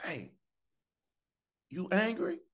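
high, tentative mock kid's question; imitating son. Son asks Dad at church if he's angry, high imitated voice. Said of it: "That boy said".

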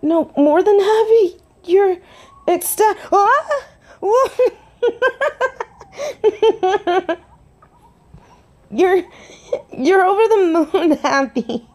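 high, breaking into tearful laughter; overjoyed. Acted. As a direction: squeal and laugh with happy relief. Overjoyed at partner's happy reaction.

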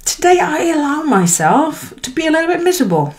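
high, lifted voice giving herself permission; a light self-encouraging voice; inner pep talk. Retold or imitated. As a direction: cheer yourself on, half laughing. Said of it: "it's like".

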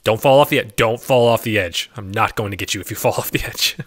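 usual speaking voice

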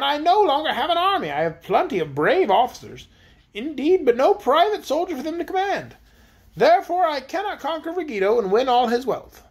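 high-pitched proud queen voice; voiced high-pitched queen, complaining; haughty queen. A narrator's reading. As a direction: play the queen, lofty and put out. Queen Anne's lines.

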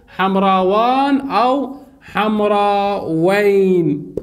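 very slow, endings held long; drawn-out demonstration. Shown as a demonstration. Teacher gives students both duals, slow drawn-out demonstration. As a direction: stretch out حمراوان and حمراوين.

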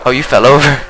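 usual speaking voice

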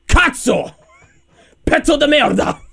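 high, hammed-up swearing; angry Italian cursing. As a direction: curse theatrically in Italian.

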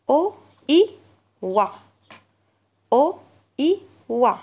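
O, I, then blend into "wa"; sounding out O I wa. Shown as a demonstration. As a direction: say "O, I" apart, then join them into "wa". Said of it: "Faites comme moi".

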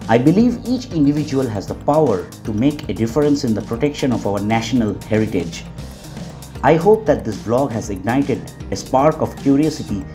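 calm, polished closing narration; voiceover narration. Acted. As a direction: read it like a documentary outro.

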